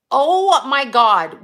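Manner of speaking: slow, loud oh my God; shocked exclamation. Real, not acted. Mother learning son poured cleaner in eyes, slow loud shock.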